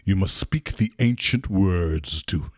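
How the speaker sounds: low, slow mystical greeter; solemn ancient guide; mystic sage voice. Pretend. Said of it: "Nah, just messing with you".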